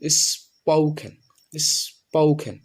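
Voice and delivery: slow drawn-out স্পোকেন; careful spoken. Shown as a demonstration. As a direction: say স্পোকেন slowly as spo-ken, no ph. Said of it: "এটা আমরা বলবো". (ধীরে স্পষ্ট উচ্চারণ স্পোকেন)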